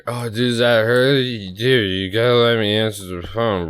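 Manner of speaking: slurry drunk pleading, imitated. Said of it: "getting just plastered".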